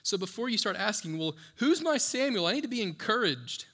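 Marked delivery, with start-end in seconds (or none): 1.2-3.6 mock needy plea. Mock needy churchgoer asking.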